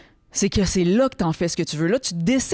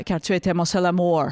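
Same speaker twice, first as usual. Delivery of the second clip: put-on English accent in French; mock English-accented love poem. Shown as a demonstration. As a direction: say the poem as an English speaker would. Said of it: "avec un accent anglais".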